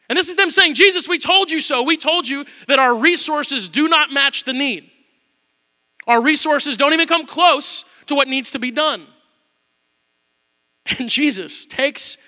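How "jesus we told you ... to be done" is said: higher, louder, slow I-told-you-so. Retold or imitated. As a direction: rub it in.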